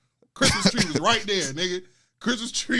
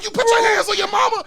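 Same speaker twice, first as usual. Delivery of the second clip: high, loud outraged charge; pitched-up yelling accusation; mock accusation; 9 dB louder than usual. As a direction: act out an indignant scolder, shouting. God brother's accusation voiced.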